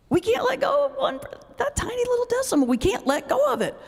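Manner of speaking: mock whiny exasperation. Real, not acted. Mocking our pettiness.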